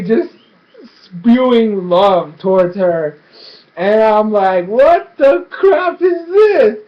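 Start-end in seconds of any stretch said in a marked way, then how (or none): 4.7-6.8 high, loud, stretched-out mock outcry; incredulous shriek. Viewer reacting to gushing love talk, incredulous shriek. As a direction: wail it slowly in exaggerated disbelief.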